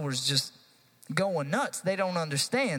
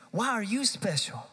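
preaching climax; 6 semitones higher than usual. Real, not acted. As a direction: preach it to the peak.

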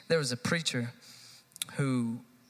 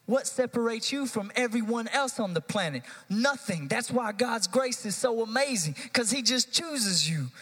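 preaching climax; 6 semitones higher than usual. Real, not acted. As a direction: preach it to the peak.

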